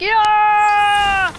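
long, high held "yeah"; imitated yell. Player imitates what it's like, long high yell. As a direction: draw the cry out high. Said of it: "It's like".

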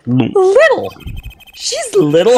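high, loud squeal of disbelief; incredulous. Real, not acted. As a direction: shoot way up in disbelief. Incredulous at the nickname Little.

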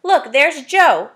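loud call. Acted. What's-his-name pointing out.

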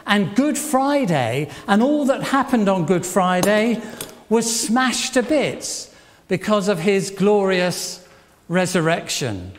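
ordinary talk, nothing marked

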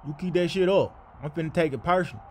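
normal talking voice

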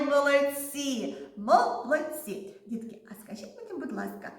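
ordinary delivery

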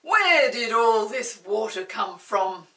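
Mister Archimedes bellows at his friends; bellowing. Acted. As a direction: roar the question across the bathroom. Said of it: "bellowed Mister Archimedes".

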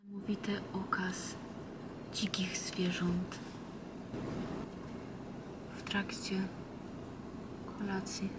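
hushed wildlife narrator whisper; nature documentary whisper. Pretend. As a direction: whisper like filming wild animals. (szept jak w filmie przyrodniczym)

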